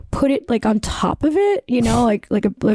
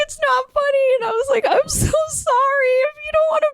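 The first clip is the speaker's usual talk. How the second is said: high, tearful imitation; high tearful pleading; mock crying plea; 11 semitones higher than usual. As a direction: replay the sobbing apology, half laughing.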